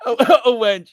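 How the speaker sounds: giggling through the word; laughing. Real, not acted. Laughing repeat.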